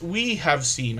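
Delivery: usual speaking voice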